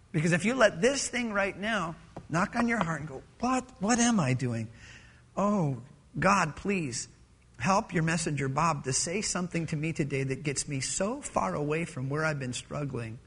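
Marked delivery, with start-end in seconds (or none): 3.4-13.2 soft, low-pitched prayer; humble plea. Acted. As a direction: plead with God quietly from a troubled heart. Acts a struggler's prayer.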